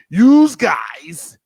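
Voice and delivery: loud Jersey "yous guys"; New Jersey accent. Shown as a demonstration. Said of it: "in my New Jersey accent".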